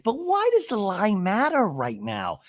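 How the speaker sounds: high, slow imitated wail; mock-anguished question; exasperated plea. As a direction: stretch out a hammed-up appeal for reason. Acting out Gina's exasperated plea.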